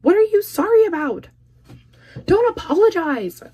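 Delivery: high, loud disbelieving question; exasperated. Acted. Telling viewers off for saying sorry, in a high loud scold. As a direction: refuse the apology outright.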